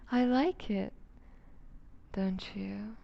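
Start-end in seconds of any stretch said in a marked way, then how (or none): 2.1-3.0 a soft, soft whisper; intimate whisper. Acted. Captor cupping her captive's face, coaxing whisper. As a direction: coax agreement under your breath.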